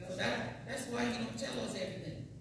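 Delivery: ordinary talk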